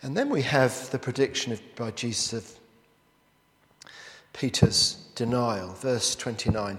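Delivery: ordinary talk, nothing marked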